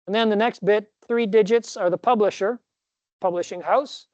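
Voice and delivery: normal talking voice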